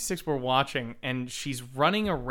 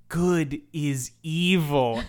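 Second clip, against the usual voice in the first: mocking slow delivery; much slower than usual. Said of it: "almost fell out of my seat in annoyance".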